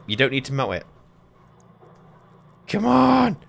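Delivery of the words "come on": high, loud, stretched cry; desperate yell. Real, not acted. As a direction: yell at it to hurry. Desperate for water to fill.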